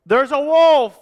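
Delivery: high, loud cry of alarm; yelling. A narrator's reading. Boy running into town with a false alarm, yelling. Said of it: "he yelled".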